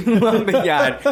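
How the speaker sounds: high, slow, laughing "lumber yard" with no R sounds; laughing mock New England "lumber yard"; laughing New England accent. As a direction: drawl "lumbah yahd" through a laugh. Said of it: "New England accent".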